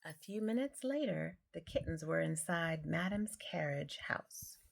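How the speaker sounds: usual speaking voice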